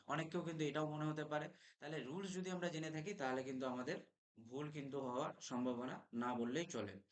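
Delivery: ordinary talk, nothing marked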